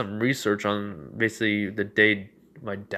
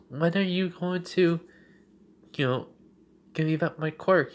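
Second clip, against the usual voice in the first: put-on higher-pitched voice for a young girl; questioning Eri; 7 semitones higher than usual. Young girl asking the shadow for her quirk, high questioning voice. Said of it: "she does ask".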